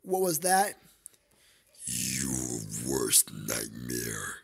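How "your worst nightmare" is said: very deep, slow, menacing growl; demonic voice. Acted. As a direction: speak like a nightmare monster. Menacing reply, deep slowed voice.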